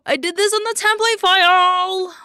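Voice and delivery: sudden high, loud panicked cry; gasping alarm. Real, not acted. Streamer to chat, shocked by her mistake. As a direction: gasp and shout the realization.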